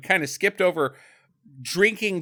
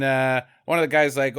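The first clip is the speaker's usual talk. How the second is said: ordinary talk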